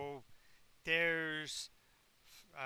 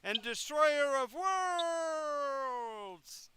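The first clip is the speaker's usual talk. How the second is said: slow, booming, much higher villain declaration; evil proclamation; 9 semitones higher, 5 dB louder, much slower than usual. Acted. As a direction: roar your dark title to the heavens. Lich declares his title.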